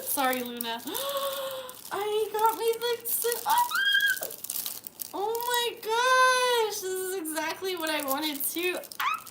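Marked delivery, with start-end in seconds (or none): 0.8-9.3 high, loud, slow oh my gosh; excited. Real, not acted. Unboxing her friend's present, high thrilled gushing. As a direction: gush over it, thrilled. Finding the gift she wanted.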